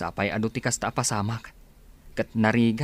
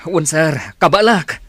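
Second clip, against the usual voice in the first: straining effort; 3 semitones higher, 10 dB louder than usual. Acted. Straining to carry madam.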